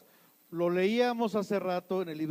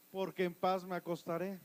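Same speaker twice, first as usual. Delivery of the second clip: usual speaking voice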